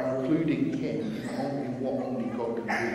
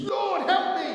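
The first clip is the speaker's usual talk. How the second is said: much higher, shouted cry for help; desperate scream. Retold or imitated. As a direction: scream it as if sinking. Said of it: "So he screams".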